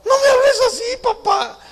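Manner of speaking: high-pitched, indignant imitation of a daughter; protesting daughter. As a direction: imitate an offended daughter objecting. Acted daughter protests at father.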